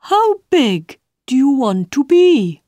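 high, loud, slowish caterpillar. Acted. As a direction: call it earnestly as a bug. Caterpillar voiced by the reader.

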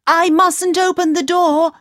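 put-on a frightened young princess. A narrator's reading.

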